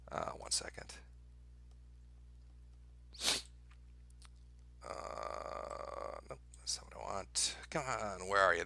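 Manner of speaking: whispered muttering while searching; whisper to self. Real, not acted. Streamer searching for the map, whispering to himself. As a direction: breathe it to yourself under your breath.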